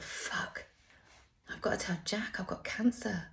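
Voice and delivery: unvoiced, hushed dread; panicked whisper. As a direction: whisper it as the fear hits. Panicked thought on realising.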